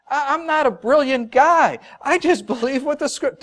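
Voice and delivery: high-pitched, emphatic plea of simplicity; humble protest. Real, not acted. Preacher tells congregation he simply believes scripture, high earnest protest.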